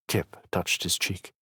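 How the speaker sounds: usual speaking voice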